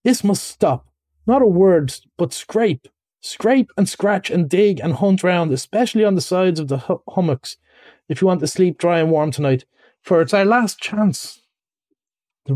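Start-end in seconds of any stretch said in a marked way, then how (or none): 0.0-11.4 high, insistent orders; mildly angry, urgent command; rather angry. Acted. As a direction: urge a friend to work, crossly. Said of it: "really rather angry".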